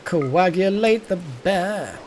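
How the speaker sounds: ordinary talk, nothing marked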